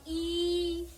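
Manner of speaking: ई held out long and slow. Shown as a demonstration. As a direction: stretch the vowel ई. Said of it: "ज्यादा समय लगेगा". (लंबा खींचा हुआ ई)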